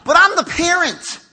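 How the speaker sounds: high, loud mock self-justification; indignant parent. Parent excusing himself to the kids, mock indignant. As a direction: insist on your rank, hammed-up.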